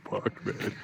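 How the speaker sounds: whispered under the breath in alarm; nervous whisper. Retold or imitated. Storyteller reliving his panic beside the biker, whispered. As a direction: hush it like you're scared to be heard. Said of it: "I was nervous".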